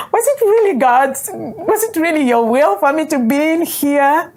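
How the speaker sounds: high-pitched mock lament to God; retold wail of rueful questioning; incredulous plea. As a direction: plead upward in disbelief, half laughing at yourself. Retold plea to God, laughing at herself.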